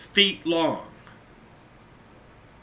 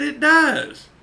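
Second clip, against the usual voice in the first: emphatic agreement; 10 semitones higher, 5 dB louder than usual. Retold or imitated. Agreeing with the officer's remark.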